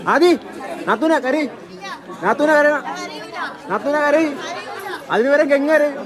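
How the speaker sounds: teasing call-out. Real, not acted. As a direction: egg them on, calling across the room. Teasing bride's family to cry.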